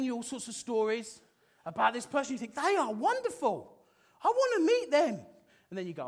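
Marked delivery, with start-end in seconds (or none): 2.6-5.2 high, loud, starry-eyed gush; gushing excitement. Pretend. Single friend hearing about a perfect match, gushing high and loud. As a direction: swoon over someone you've never met.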